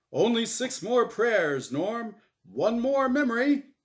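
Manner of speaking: loud, high, cheery host voice; game show host. Acted. Game show host Azrael cheers Norm on, loud and bright. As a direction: boom it out like a TV emcee. Host urging final memory.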